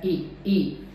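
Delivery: ई as a pure, separate "ee"; second-vowel demo. Shown as a demonstration. Teacher showing the second sound, clean repeated ई. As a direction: say ई twice with a gap, evenly. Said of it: "second sound फिर भी वही है".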